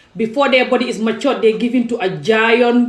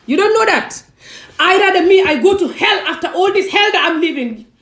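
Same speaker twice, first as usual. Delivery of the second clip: emotional outburst; 8 semitones higher than usual. Real, not acted. Emotional outburst about going to hell.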